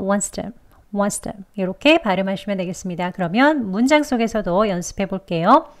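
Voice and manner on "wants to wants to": wants to very soft, t nearly gone; weakest wants to. Shown as a demonstration. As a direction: breathe out wants to, quiet and quick. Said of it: "마지막은 약하게요".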